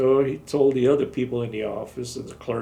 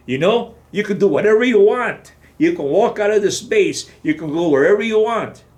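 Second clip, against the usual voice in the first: loud, higher lecture; retold colonel scolding; lecturing colonel; 8 semitones higher, 8 dB louder than usual. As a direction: lay it out loudly to the soldier.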